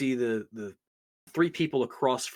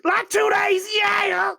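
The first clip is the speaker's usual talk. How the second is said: much higher and louder far-off yell; shouted from the back; 9 dB louder, much slower than usual. Acted. Cook answers the bartender from the back, loud high yell. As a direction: holler from the kitchen. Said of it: "you hear from the back kitchen".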